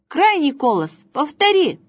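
high, loud classroom call; bossy little teacher. Pretend. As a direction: call on a pupil. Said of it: "урок арифметики".

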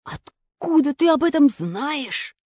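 shaky, slow frightened question; trembling voice. A narrator's reading. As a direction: ask with a quavering voice. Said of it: "дрожащим голосом".

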